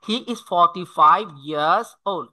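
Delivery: very slow, "years" as "yaz"; native-like years. Shown as a demonstration. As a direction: drop the R in "years". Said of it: "ইয়ার্সকে বলতে হয় অনেকটা ইয়ার্সের মতো". (ধীরে, "years" বলুন "ইয়াজ")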